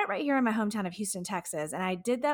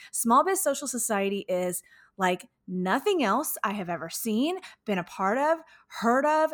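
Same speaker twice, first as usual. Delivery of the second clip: ordinary talk, nothing marked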